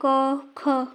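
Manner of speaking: क, ख said slowly and distinctly from the throat; like a teacher drilling letters; slow articulation demo. Shown as a demonstration. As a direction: spell out क and ख slowly, stressing the throat sound. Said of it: "बोल कर देखिए".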